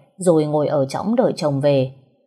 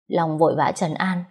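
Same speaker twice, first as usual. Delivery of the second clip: ordinary delivery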